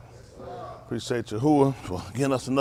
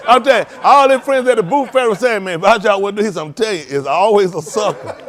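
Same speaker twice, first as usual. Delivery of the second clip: loud, high, laughing storytelling; laughing retelling; 8 semitones higher, 13 dB louder than usual. Retold or imitated. Retelling the boot seller's joke to the congregation, laughing loudly. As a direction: tell it through laughter, half shouting.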